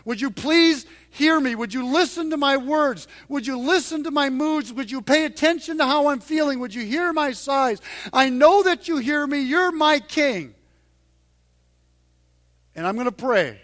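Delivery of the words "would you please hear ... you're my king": louder, earnest spoken prayer; heartfelt model prayer. Acted. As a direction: pray it out to God with conviction. Prays aloud as an example.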